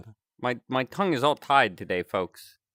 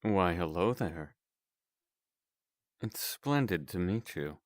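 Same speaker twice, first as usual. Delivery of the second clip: lower, softer voice-changer character; smooth, courteous voice changer; morphing voice; 5 semitones lower, 7 dB quieter than usual. Acted. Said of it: "Voice changer".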